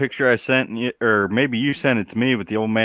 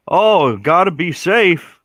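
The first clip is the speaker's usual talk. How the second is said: slow, louder mock reply, pitched up; mock dopey excuse; 6 semitones higher, 5 dB louder, much slower than usual. Mocking the Walmart cart boy's reasoning, slow and hammed-up. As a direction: drag it out like a clueless kid.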